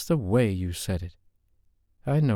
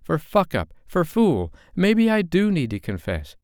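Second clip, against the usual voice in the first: put-on higher, louder young woman flaring up; rising temper; 9 semitones higher, 4 dB louder than usual. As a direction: let her temper boil over, pitched up. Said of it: "Her voice rose with his as her temper flared".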